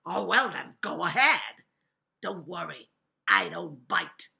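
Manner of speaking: low, loud wolf voice; big bad wolf. A narrator's reading. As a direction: falsely reassure as the wolf. Wolf reassures the piano tuner.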